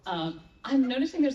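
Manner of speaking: ordinary talk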